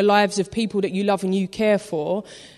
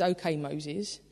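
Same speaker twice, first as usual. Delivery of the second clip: soft giving in; 6 semitones lower, 10 dB quieter, slower than usual. Retold or imitated. God relents quietly.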